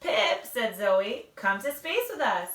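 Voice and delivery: lively read-aloud with character voices; bright storybook reading for little listeners; reading to children. Acted. Mom reads a picture book to second graders, lively and animated.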